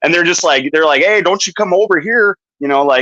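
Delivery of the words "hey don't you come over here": higher-pitched Canadian border officer; imitated Mountie. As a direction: lightly call out as a Mountie. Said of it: "the Monties are just sitting there".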